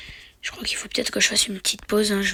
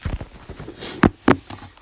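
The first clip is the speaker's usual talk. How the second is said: real cough. Real, not acted. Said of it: "j'ai mal à la gorge".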